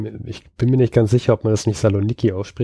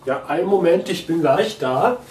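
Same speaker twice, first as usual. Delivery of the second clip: higher, chatty sketch voice; Harald character; 5 semitones higher than usual. Acted. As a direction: play a fussy busy man greeting a friend. (höher gestellte Sketchstimme als Harald)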